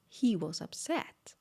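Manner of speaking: usual speaking voice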